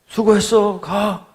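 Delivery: imitating an encouraging driver; hearty send-off. Truck driver sending the youth off, heartily.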